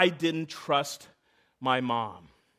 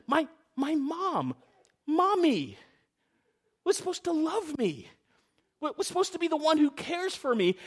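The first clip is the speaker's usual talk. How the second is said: put-on hurt child; 8 semitones higher than usual. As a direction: let it sound like a betrayed child. Child's hurt feelings about mom.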